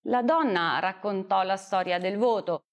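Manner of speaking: ordinary delivery, nothing marked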